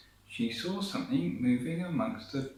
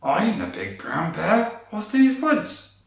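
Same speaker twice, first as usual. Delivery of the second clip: loud, slow hammed-up bear roar; 7 semitones higher, 8 dB louder than usual. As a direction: boom it out like a big bear. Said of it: "If you could sound more like a bear".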